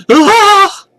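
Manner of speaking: piercing frantic scream. A narrator's reading. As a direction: shriek again, all out. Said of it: "he screams again".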